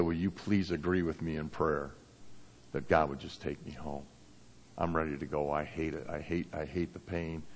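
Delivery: very soft, low, sorrowful retelling; hushed and heavy, quoting his weary plea; subdued plea. Retold or imitated. Father retelling his son asking to go home, hushed and sorrowful. Dying son's plea to parents.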